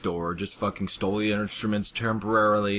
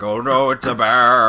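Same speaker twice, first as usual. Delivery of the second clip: loud, hammed-up cry of alarm; mock crowd panic; 2 semitones higher, 8 dB louder than usual. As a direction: yell it like a fleeing crowd. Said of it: "crowd's there running away and like".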